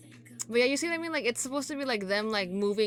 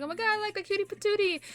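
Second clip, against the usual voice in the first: much higher, squealing gush over a cute idol; gushing over cutie; 11 semitones higher than usual. Retold or imitated. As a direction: coo like you just saw the cutest thing. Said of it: "'cause I'm just like".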